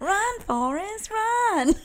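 high, slow mock Southern call; Southern accent. Quoting Jenny calling to Forrest, bad Southern accent. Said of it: "I like the way you do that accent".

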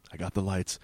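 low, soft retold aside; hushed imitation of a buddy; low murmur. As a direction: drop low and quiet, imitating him. Retold tender bedtime line from Pete.

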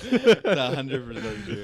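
slow, laughing insistence. Real, not acted.

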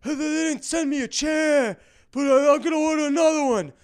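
high-pitched Italian-neighbor yelling; loud, drawn-out caricature of an Italian grumbler; old Italian guy. Acted. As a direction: holler it slowly like an old Italian man complaining. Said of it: "Between the Italian guy going".

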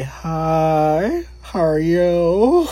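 high, slow sugary greeting; put-on flirty voice. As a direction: sweeten the voice to flirt with him. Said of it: "Changed my voice and everything over".

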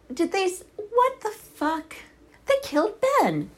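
real high outraged yell; shrieking outrage. Real, not acted. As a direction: scream it at the screen in disbelief. Reacting to favourite character's death.

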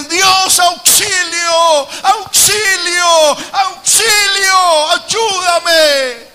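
high, loud, slow, near-sobbing cries; tearful shouted plea for rescue; desperate cry for help. Acted. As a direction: beg God to save you.

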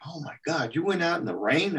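high-pitched retold disbelief; imitated astonishment. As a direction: act out someone's astonished question. Barbera astonished at soaked kid.

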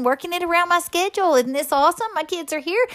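normal talking voice

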